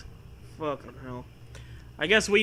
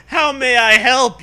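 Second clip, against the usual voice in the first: high, loud operator greeting; 7 semitones higher, 10 dB louder than usual. Acted. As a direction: chirp like a phone operator. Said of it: "you ask you".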